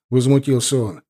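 usual speaking voice

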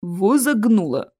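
plain reading voice

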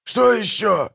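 high, exasperated yell; crying out. A narrator's reading. Captain hearing a new bark from shore, crying out. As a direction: cry out at the next threat. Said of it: "закричал Пудлорак".

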